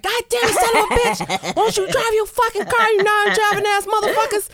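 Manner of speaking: high-pitched yelling at another driver; acted road rage. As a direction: explode mid-chat into mock traffic fury. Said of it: "You hear all this extra shit".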